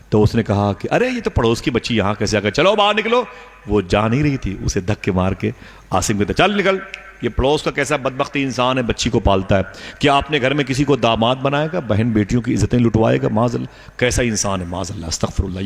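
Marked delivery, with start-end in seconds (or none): 0.9-3.3 high angry shout on निकलो; angry order out. Retold or imitated. Father throws out unknown girl. 6.3-15.6 angry scolding. Retold or imitated. Father scolds and pushes girl out.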